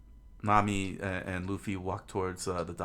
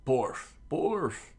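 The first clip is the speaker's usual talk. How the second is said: loud, higher dog barks; Chow Chow; 7 semitones higher, 5 dB louder than usual. A narrator's reading. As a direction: bark the word like a guard dog. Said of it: "Chow Chow said".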